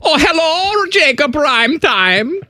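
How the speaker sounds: put-on high, slow German accent, teasing and sing-song; a high-pitched German lady asking about nachos. Co-host teases Reimer about his nachos in high German accent. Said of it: "you do a good German accent?".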